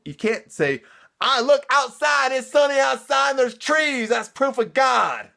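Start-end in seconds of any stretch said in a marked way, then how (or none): 1.2-5.3 high, shouted mock Southern believer; hammed-up Southern accent, pitched way up and loud. Believer claiming trees as proof of God, shouted mock Southern accent. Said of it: "using a Southern accent".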